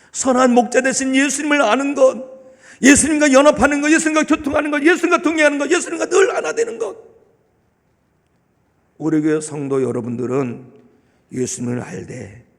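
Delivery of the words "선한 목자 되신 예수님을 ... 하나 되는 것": higher, louder rising proclamation; sermon climax chant; fervent preaching. Acted. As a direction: build each phrase higher. Preaching list building up.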